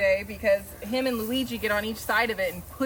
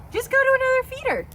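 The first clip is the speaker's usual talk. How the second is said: much higher, faster pet voice; high squeaky voice; 4 dB louder, faster than usual. Acted. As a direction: squeak it at the goat, fast. High voice repeats to goat.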